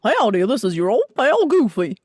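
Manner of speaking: Goofy with long face shape; long-faced Goofy greeting; Goofy voice, stretched face; Goofy impression. Shown as a demonstration. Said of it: "more of this shape".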